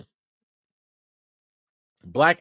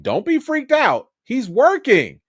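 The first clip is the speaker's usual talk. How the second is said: higher, louder quoted reassurance; retold insistence; 7 semitones higher, 6 dB louder than usual. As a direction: insist emphatically, as if quoting the director. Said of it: "I was like".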